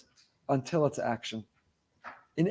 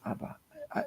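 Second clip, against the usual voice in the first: mock whisper, barely voiced; hushed confession; 16 dB quieter than usual. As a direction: whisper it like a secret vow. Son's hushed declaration of love.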